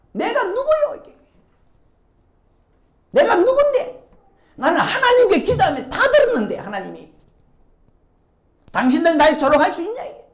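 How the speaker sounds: high, loud outraged protest; indignant Job. Acted. Job shouting at people who mock him, high indignant outrage. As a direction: demand who dares mock you.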